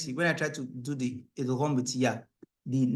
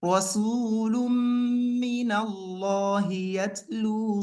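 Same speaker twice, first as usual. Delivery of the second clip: chanted, higher, emphasizing idgham; recap recitation; 7 semitones higher, faster than usual. Shown as a demonstration. Teacher's recap reading stressing idgham places.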